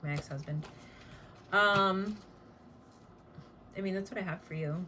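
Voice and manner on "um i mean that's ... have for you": ordinary talk, nothing marked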